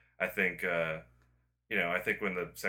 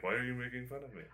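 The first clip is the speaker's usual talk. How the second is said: bad Swedish accent; 3 semitones higher, 8 dB quieter, faster than usual. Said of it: "That was a really bad Swedish accent".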